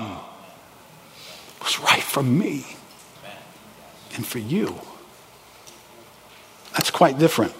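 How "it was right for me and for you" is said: emotional hush. Real, not acted. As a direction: drop to a tender near-whisper. Emotional climax then hush.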